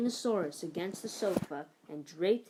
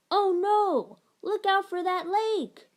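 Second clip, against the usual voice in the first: high, loud, slower warning cry; alarmed cry; 11 semitones higher, 7 dB louder, slower than usual. Acted. Pilot warning crew of the syrup lake, alarmed cry. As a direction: shout a pretend crash warning. Pretend spaceship about to crash.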